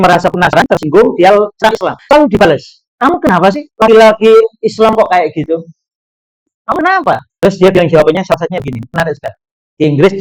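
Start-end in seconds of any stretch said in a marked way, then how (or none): 3.0-7.2 higher, rising to a pitched demand; indignant campus queen. Retold or imitated. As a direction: press him, half-mocking. Offended queen attacks.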